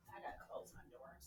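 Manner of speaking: quick breathy whisper, hardly any voice; hushed whisper. Real, not acted. Whispering to viewers so people downstairs won't hear. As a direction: drop to a fast whisper so nobody hears.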